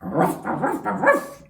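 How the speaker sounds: mock barking, rising; dog bark. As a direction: play the dog barking back. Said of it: "what did the dog say".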